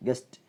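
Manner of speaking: "ഗസ്റ്റ്" as guest, crisp final t; correct t ending. Shown as a demonstration. Teacher corrects learners, "ഗസ്റ്റ്" ending in t. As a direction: end "ഗസ്റ്റ്" voiceless, without a vowel. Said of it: "അങ്ങനെയല്ല പറയാ".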